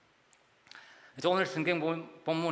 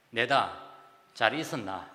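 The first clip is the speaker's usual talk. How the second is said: blunt Gyeongsang greeting, a bit louder; Gyeongsang dialect; 3 semitones lower, 3 dB louder than usual. Retold or imitated. Gyeongsang man phoning an old friend, Gyeongsang dialect. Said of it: "이렇게 이야기합니다".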